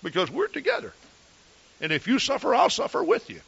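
lightly voiced loyal wife; wife's voice. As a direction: quote his devoted wife quickly, lifting up. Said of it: "Because she says".

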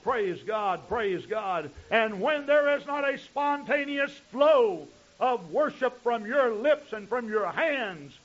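normal talking voice

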